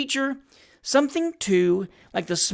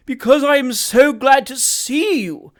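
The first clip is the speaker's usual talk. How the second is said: fawning hypocrite; 8 semitones higher, 7 dB louder than usual. Acted. Job Trotter gushes false feeling to Sam, fawning voice. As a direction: overdo the heartfelt emotion.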